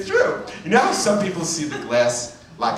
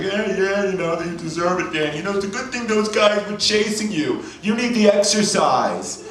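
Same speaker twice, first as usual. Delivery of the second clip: tough brother; 5 semitones higher than usual. Retold or imitated. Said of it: "a really tough brother".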